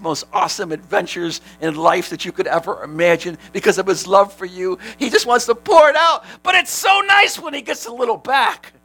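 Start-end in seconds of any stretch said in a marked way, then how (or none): 5.0-8.7 fervent preaching. Real, not acted. Preacher raises voice.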